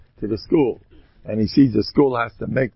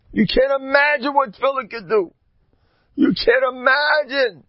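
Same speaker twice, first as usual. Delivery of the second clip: high, loud preaching voice; a raised, urgent sermon call; fervent preaching; 14 semitones higher, 7 dB louder than usual. Acted. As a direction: rouse them to pray before every meeting. Urging the listeners at full force.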